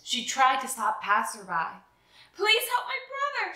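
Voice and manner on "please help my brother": high pleading call for help; little sister pleading for her hurt brother, high call. A narrator's reading. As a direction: call out high, begging strangers. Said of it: "she called".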